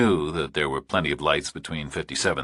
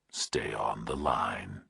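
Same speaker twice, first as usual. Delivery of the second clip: slow, low, menacing whisper; distorted nightmare voice; 8 semitones lower, 10 dB quieter, slower than usual. A narrator's reading. Kidnapper on the phone to Burke, slow eerie whisper. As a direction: breathe it like a monster on the line.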